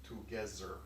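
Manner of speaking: put-on "Together" as "togezzer" in a German accent; low German-accented "Together", th turned to z. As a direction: play a German guy, z for the th in "Together". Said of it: "he says it with a German accent".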